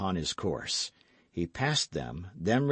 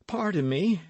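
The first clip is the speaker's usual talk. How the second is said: pleading lover; 7 semitones higher than usual. A narrator's reading. D'Artagnan pleading with the duke.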